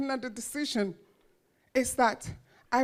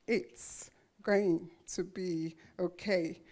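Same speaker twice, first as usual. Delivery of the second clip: soft, slow and gentle; quiet, slow reassurance to a frightened young mother; reassuring; 6 semitones lower, 8 dB quieter, slower than usual. Retold or imitated. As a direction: comfort her quietly, slowly. Author comforting her at signing.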